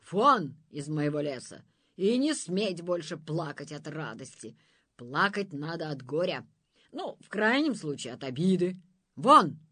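loud furious order; monster's fury. A narrator's reading. Monster banishing the souls, shouting. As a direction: throw them out.

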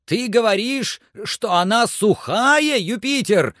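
very high, strained shout upward; breaking-voice cry. A narrator's reading. Master questions climber about the dry branch, agitated cry. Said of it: "закричал Легран прерывающимся голосом".

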